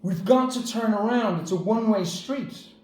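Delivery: retelling the shout at the panicking driver; mock shout. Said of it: "I shouted at him".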